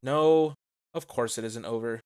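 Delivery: ordinary delivery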